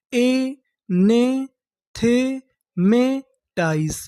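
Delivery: very slow, each syllable spoken separately with pauses. Shown as a demonstration. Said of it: "तो हमारी पूरी meaning क्या बनी?". (अक्षर-अक्षर तोड़कर धीरे-धीरे)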